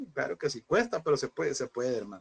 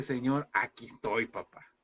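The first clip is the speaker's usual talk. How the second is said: tender prayer; 4 semitones lower, slower than usual. Acted. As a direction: hush it like a tearful prayer. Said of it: "pero decirle".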